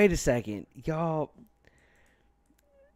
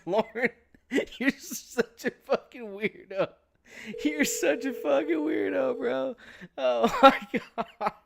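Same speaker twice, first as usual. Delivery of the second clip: laughing; 9 semitones higher than usual. Real, not acted. Still laughing at caller.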